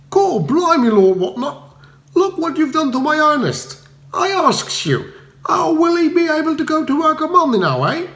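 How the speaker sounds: Cockney wife berating the lord; indignant cockney wife. A narrator's reading. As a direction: scold him for hurting her man. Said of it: "a choir of expostulation".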